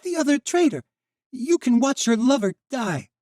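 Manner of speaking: high, loud taunting scream; a boy jeering at his enemy's lover; hateful shout. Acted. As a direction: scream it at her with pure hate. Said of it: "his eyes alight with hate".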